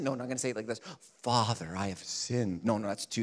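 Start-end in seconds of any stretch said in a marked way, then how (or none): 1.2-2.6 hammed-up grave confession; too dramatic. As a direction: lower and softer, heavy and mournful. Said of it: "that's too dramatic".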